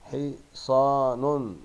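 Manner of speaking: "حصان" very slowly as "hi... saa... nun"; tanween damma ending. Shown as a demonstration. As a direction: stretch حصان out to a voiced -nun. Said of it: "وانطق التنوين بالضم".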